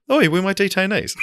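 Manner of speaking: high put-on guard voice; British guard quote. Retelling the film scene, high put-on guard. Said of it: "touches his earpiece and goes".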